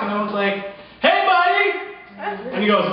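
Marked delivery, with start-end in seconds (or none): 1.0-1.7 loud, high call up the street. Retold or imitated. DJ calling the promoter up the block, loud high shout. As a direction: yell to him from far away.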